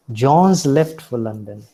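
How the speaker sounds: "John's" run together as one word; contracted weak form. Shown as a demonstration. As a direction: shrink has to a z on "John's". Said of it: "They do not say".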